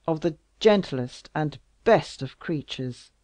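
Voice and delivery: loud, raging royal oath; exasperated king. Acted. King swearing to strike her enemies, loud rage. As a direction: swear it with fury.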